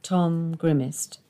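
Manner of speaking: ordinary delivery, nothing marked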